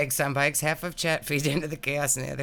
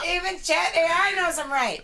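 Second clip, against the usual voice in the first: gleeful; 4 dB louder than usual. Real, not acted. Teasing boast over laughter.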